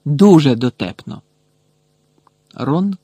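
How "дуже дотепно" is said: loud, pointed mock praise; sarcastic. A narrator's reading. Hermione to Pansy and her gang, sarcastic. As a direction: hit the first word with scorn. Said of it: "саркастично звернулася Герміона".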